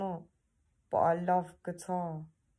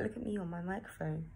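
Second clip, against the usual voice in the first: light mock show-off voice, fast and soft; teasing imitation; 8 dB quieter, faster than usual. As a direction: tease her, a quick show-off voice. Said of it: "You'll be like".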